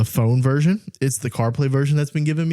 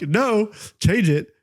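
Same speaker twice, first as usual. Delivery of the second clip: slow, higher, exasperated mock command; retold anger; 7 semitones higher, much slower than usual. Correcting Siri once more, mock angry. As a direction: act fed up, drawing out the words. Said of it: "now I'm just angry".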